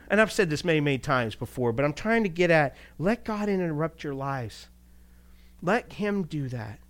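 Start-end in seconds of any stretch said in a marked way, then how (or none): none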